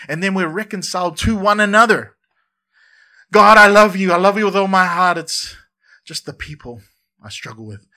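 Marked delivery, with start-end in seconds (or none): 3.3-5.2 loud, high, gushing "I love you, God"; hammed-up churchgoer gushing; mock fervent declaration. 5.2-7.8 mock churchgoer's quiet admission; sheepish aside.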